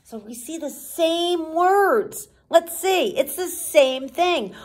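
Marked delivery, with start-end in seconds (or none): none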